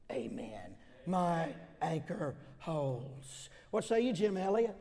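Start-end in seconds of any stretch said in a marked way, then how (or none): 0.1-0.8 whispered amen. Acted. Luther ending his prayer before the council, whispered. 1.1-3.5 very slow, every word weighted; slow refrain. Acted. Preacher voicing Luther's final word, very slow.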